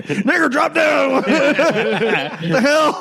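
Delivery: high, drawn-out mock warning cry; battlefield shout. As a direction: call out a warning across the battlefield.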